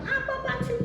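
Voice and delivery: high, quick protest with laughter; mock annoyed child. Child protesting to Pak Parmin while searching, high and indignant. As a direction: act out the child's indignant retort.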